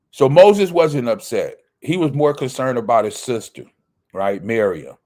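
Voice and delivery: normal talking voice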